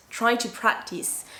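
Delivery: ordinary talk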